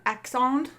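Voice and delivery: Alexandre said the French way; French pronunciation. Pretend. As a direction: say Alexandre as if French, ending softened to "-ahndr". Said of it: "I speak French".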